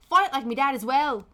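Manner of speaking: punchy put-on Irish accent; attempted Irish accent. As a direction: imitate a song's spoken bit in Irish. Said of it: "my attempt at an Irish accent".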